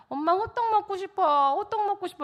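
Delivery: put-on high-pitched pleading child; young son. Son begging mom for hotteok, high childlike pleading. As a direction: voice a little boy begging for a snack. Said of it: "우리 아들이".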